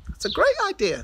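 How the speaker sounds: high, bright-eyed little voice; eager little rock. Acted. Little Rock happily agreeing to Big Rock's plan, high eager voice. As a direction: chirp it up like a small eager friend.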